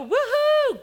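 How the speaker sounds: a high, loud woohoo; a sudden whoop of easy celebration; mock cheer. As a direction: cheer it like everything's going your way. Mock cheer when times are good.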